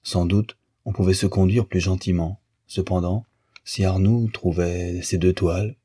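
ordinary delivery, nothing marked

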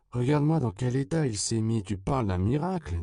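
put-on high, loud exasperated girl. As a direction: scold him like a fed-up little girl. Girl mocks drunk gringo.

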